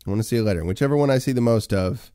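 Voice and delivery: rally the chat to answer; calling out to chat. Real, not acted. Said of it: "start throwing letters into the chat".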